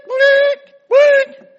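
very high, beeping blink sounds; GPS blink. Retold or imitated. Driver imitating the GPS warning, high beeps. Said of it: "this G P S thing would go blink".

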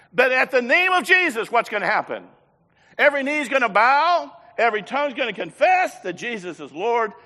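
loud, high, ringing preacher's cry; full-voiced revival preaching; preaching at full force. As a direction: lift the room, proclaim it. Preacher building to climax.

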